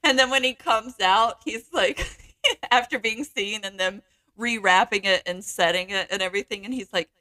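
ordinary talk

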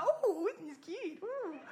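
put-on high, slow cooing child; cooing delight. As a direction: coo like a charmed little girl. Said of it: "And little Zara".

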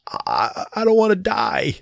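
high-pitched imitation of a tearful little girl; mock-weepy kid voice, lifting high; weepy child. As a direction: whimper it, imitating an upset seven-year-old. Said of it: "kind of being real weepy".

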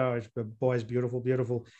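Brendan Rodgers impression. Pundit mimicking the Northern Irish manager praising his player, quick and low. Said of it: "Sound like Brendan Rodgers there".

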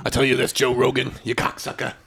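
gruff comic ranting at a rival host; Joey Diaz impression. Imitating Joey Diaz calling out Joe Rogan. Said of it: "Is that Joey Diaz?".

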